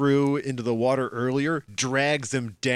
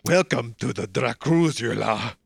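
very slow, grand host greeting; lightly voiced vampire host; hammed-up Dracula welcome; mock Dracula welcome; 2 semitones lower, 3 dB louder, slower than usual.

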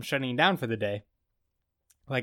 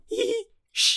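high squeaked "hee", then a hard shush; tiny high squeal cut off by a shush; imitated giggle and shush. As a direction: mimic someone giggling and shushing. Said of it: "he's giggling, going".

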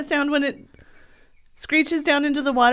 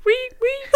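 high squeaky cricket chirps; 14 semitones higher than usual. Pretend. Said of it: "now do a cricket".

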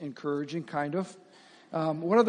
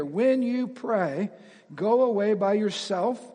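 "when you pray" stretched slow, then "go away by yourself" louder; slow it right down on "when you pray"; slow emphatic reading; 2 semitones higher, 5 dB louder, slower than usual. Shown as a demonstration. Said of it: "But when you pray".

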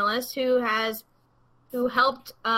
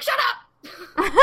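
high laughing shout of disbelief; excited squeal; 9 semitones higher than usual. Retold or imitated. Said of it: "And I was like".

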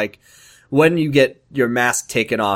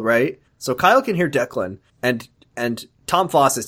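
ordinary delivery

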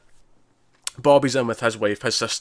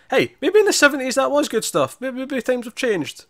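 mock pleading; 14 semitones higher than usual. Real, not acted. Teasing defence of the whisky.

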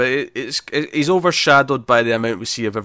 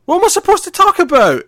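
much higher, louder put-on outburst; exasperated mock yell; 6 dB louder than usual. Boyfriend protesting the ban on Batman talk, mock-exasperated yell. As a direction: wail it in hammed-up protest.

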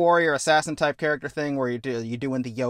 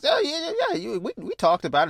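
very high, cracking little creature voice; a tiny fuzzball caught out, squeaking excuses; high-pitched sheepish alien squeak; squeaky alien pilot; 10 semitones higher than usual. Acted. Alien pilot answers captain.

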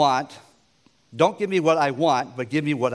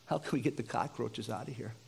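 tearful mock cry; 2 semitones lower, 13 dB quieter than usual. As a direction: choke it out quietly, overwhelmed. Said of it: "I start crying, going".

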